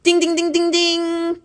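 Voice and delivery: high sung ding ding; bell imitation. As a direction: chime it like an alarm.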